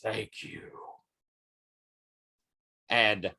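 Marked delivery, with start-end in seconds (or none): 0.0-0.7 very soft, faint last words; hushed, spent gratitude; dying thanks. Acted. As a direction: breathe it out as you bleed away. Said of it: "as he says".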